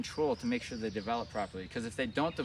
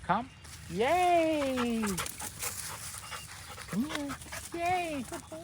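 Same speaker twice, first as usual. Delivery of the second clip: much higher, bright sing-song praise; excited dog praise; much slower than usual. Real, not acted. Trainer calling her dog, much higher excited praise.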